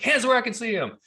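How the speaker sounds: high, loud barked order; robber's command. Retold or imitated. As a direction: bark the order at them. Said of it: "he's like".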